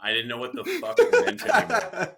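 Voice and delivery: high, loud, laughing through it; laughing exasperation. Real, not acted. Telling the crowd the words lost meaning, laughing hard. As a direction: crack up while saying it.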